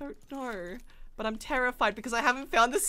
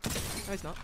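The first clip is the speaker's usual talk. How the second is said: normal talking voice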